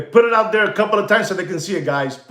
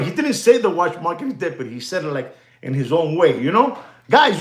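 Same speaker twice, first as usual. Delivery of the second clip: ordinary talk, nothing marked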